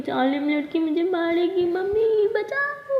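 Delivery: high, drawn-out melodramatic wail; hammed-up playful victim; mock cry for help. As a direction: wail for mummy in pretend terror.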